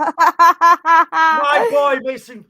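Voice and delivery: laughing, loud high cry of delight; laughing joy. Real, not acted. Fan still buzzing from the goal, a loud laughing shout. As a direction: laugh it out, overjoyed.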